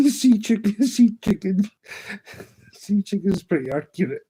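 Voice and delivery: high, giggly and laughing; helplessly tickled by the phrase; cracking up. Real, not acted. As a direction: repeat it through real laughter.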